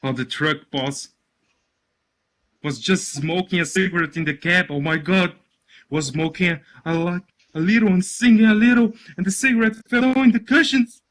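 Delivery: building to a high, loud, rushed confession; panicked explaining. Acted. Caruso confessing to the boss, panicked and building.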